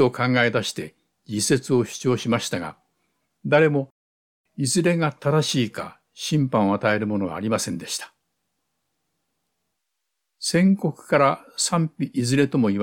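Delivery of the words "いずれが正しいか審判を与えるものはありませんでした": ordinary delivery, nothing marked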